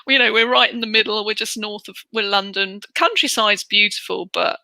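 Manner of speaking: ordinary talk, nothing marked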